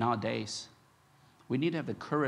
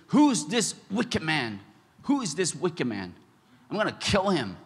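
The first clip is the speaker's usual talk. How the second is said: furious king; 4 semitones higher, 6 dB louder than usual. Retold or imitated. Preacher voicing King David in a loud furious outburst. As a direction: rage like a wronged king. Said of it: "He got so furious. He was so angry".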